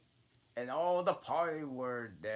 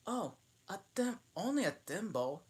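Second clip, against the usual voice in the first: sad reply; 12 semitones higher, 4 dB louder, faster than usual. A narrator's reading. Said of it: "said Al sadly".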